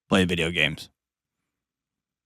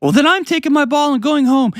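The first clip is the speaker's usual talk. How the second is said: put-on much higher whiny child voice; childish sulk. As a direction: sulk like a kid taking his ball home. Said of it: "that's how children respond".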